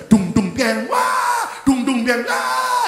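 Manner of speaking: loud drum chant breaking into high wails; mock funeral mourners in full cry; hired funeral wailers. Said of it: "Penangis, saudara".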